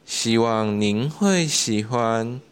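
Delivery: ordinary delivery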